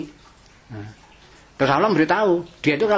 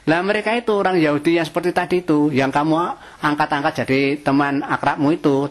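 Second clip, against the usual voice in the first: normal talking voice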